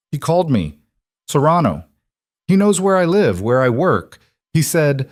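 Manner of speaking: high, loud frightened voice; fighting back panic; panicked. A narrator's reading. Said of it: "her voice cracked".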